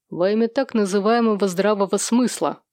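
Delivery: ordinary delivery, nothing marked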